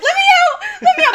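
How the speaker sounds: high loud panicked yell; screaming to be let out. Retold or imitated. Girl trapped in a blanket burrito screams at her cousin, high and loud. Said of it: "I'm screaming".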